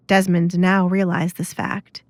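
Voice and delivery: ordinary delivery, nothing marked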